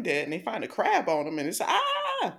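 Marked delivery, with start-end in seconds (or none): 1.6-2.4 sudden high mock scream; short retold shriek. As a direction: yelp like something just bit you. Crab victim screams in retelling.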